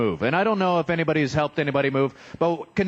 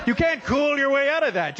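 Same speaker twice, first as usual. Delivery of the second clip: excited shout; 9 semitones higher than usual. Real, not acted. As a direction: blurt it out excitedly. Excited punchline.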